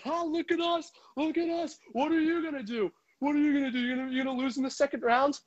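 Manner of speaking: high, sing-song mock taunt; taunting rival fan. Rangers fans taunting Islander fans, high mocking sing-song. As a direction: needle them like a smug rival fan. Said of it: "and go at them and say".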